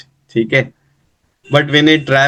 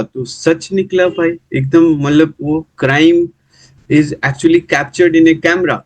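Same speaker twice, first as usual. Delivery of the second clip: ordinary talk, nothing marked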